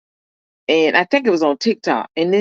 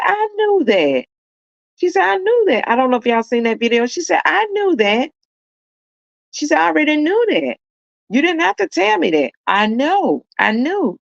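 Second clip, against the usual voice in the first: voicing the mother, pitched way up, knowing and fond; high mom voice; 9 semitones higher than usual. A mother's fond high voice, imitated. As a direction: mom tells son she already knew, high and fond.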